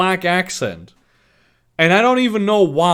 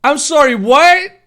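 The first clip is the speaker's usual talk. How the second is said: disbelief; 7 semitones higher, 8 dB louder than usual. Real, not acted. Reacting to absurd story line.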